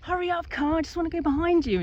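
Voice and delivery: high-pitched, urgent mock pleading; playfully exasperated urging; impatient driver. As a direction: hurry the car along, speeding up at the end. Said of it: "you're braking, braking".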